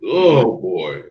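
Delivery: ordinary talk, nothing marked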